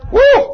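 very high, loud celebratory whoop; joyful whoop. Real, not acted. Preacher to the congregation, celebrating God with a loud whoop. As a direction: let out a shout of joy to the rafters. Preacher rejoicing after praise.

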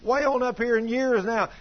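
high-pitched, loud complaint to God; fussing angrily. Retold or imitated. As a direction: act out her exasperated tirade at God. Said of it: "I was just fussing at God".

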